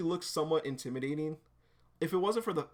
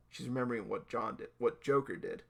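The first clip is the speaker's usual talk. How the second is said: ordinary delivery, nothing marked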